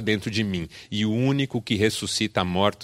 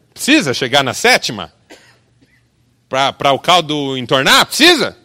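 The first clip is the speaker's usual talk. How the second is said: louder, rising rhetorical questions; preacher challenging the audience, louder each time; loud challenge; 4 semitones higher, 8 dB louder than usual. Real, not acted. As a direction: push the question at the crowd.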